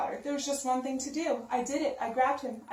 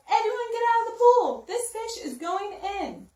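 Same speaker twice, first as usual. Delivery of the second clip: yelling; 9 semitones higher, 6 dB louder than usual. Acted. Fireman clearing swimmers from the pool, loud high yell. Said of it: "The fireman yelled".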